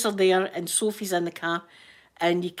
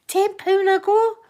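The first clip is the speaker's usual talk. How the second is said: high, loud, drawn-out disbelief; slow, high-pitched incredulous question; incredulous repeat; 13 semitones higher, 8 dB louder, slower than usual. Retold or imitated. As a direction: echo the price in mock astonishment. Retells reacting to cheap price.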